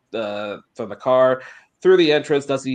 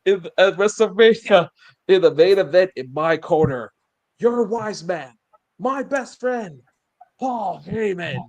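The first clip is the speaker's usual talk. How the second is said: imitated wrestler's demand; 9 semitones higher, 4 dB louder than usual. Wrestler claiming the wise man for his corner, high acted promo. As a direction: act out each phrase as a pointed demand.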